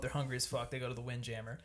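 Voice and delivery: ordinary talk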